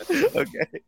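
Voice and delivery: high squealing laugh. Real, not acted. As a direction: crack up, then gasp okay. Cracking up at the bit.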